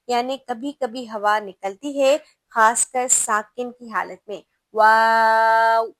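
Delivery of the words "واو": واو drawn out very slowly and loudly; letter waw stretched out in a slow, loud Qur'anic model; demo pronunciation. Shown as a demonstration. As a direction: hold the واو long and full, as a model for students. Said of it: "ابھی ہوا نہیں نکل رہی".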